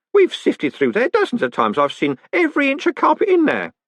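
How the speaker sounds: high-pitched, indignant insistence; protest, pitch climbing high. A narrator's reading. Groat insisting he searched everywhere, protesting. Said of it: "Groat protested".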